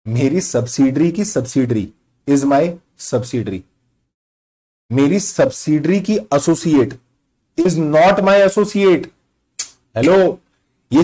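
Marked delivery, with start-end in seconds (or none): 0.1-3.6 slow, deliberate dictation; measured, word-by-word repeat. Shown as a demonstration. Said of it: "बताओ ना दोबारा". 4.9-9.1 drawn-out, stressed "is not my associate"; emphatic slow repeat. Shown as a demonstration. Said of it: "बताओ ना दोबारा".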